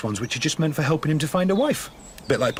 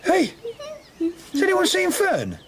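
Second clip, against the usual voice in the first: calling out. Acted. Playful search calling for hidden child.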